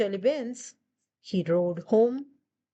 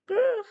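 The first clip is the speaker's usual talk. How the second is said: much higher, louder mock growl; dog growling; 12 semitones higher, 3 dB louder than usual. Dog fighting Wolf over the shoe, high loud mock growl. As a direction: yap out a squeaky little growl. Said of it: "said dog".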